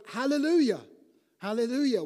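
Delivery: loud, high hallelujah; preacher rousing the congregation to praise; exuberant praise. Shown as a demonstration. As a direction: call it out with joy. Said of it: "exuberant praise of joy".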